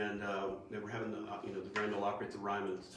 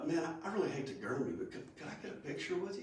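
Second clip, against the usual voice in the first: lightly imitated shy fan, quick and higher; like a little kid; 6 semitones higher, 6 dB quieter, faster than usual. Drummer asking his hero for a picture, a touch of little kid. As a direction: rush the request softly, pitched up. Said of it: "I feel like a little kid".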